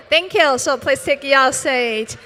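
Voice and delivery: ordinary talk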